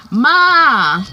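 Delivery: loud, high yell for mom; drawn-out acted yell. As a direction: holler it out like a son calling his mother. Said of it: "he's yelling".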